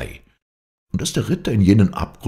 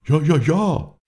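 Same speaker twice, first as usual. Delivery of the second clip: high, loud repeated echo voice; calling echoes; 13 semitones higher, 5 dB louder, slower than usual. A narrator's reading. Echoes answer the knight's question, high ringing call. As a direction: ring it out like echoes from an abyss. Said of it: "riefen die Echos".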